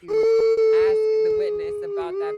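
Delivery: furious shout. Real, not acted. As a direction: bellow it out slow and loud. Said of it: "Start crying really loud".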